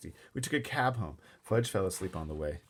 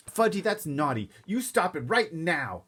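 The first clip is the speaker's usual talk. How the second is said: scolding mother; 8 semitones higher, 5 dB louder than usual. Acted. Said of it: "my mother said".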